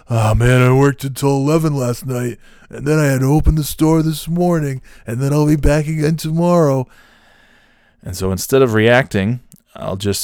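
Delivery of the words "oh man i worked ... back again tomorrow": higher, put-on complainer voice; mock self-pitying lament; woe-is-me whine. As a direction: moan through the work schedule, imitating him. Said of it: "Oh, woe is me".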